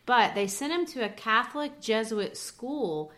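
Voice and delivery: ordinary talk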